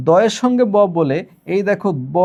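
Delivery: ordinary talk, nothing marked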